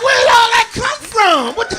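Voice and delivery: loud, high mock shock; a startled yell of disbelief; shocked outcry. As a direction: blurt it out stunned. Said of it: "I'm like".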